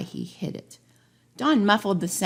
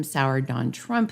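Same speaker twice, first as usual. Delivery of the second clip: ordinary delivery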